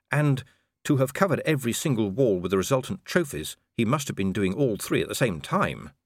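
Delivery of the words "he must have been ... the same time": ordinary delivery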